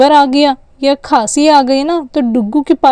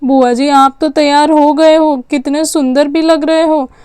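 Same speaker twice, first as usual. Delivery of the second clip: usual speaking voice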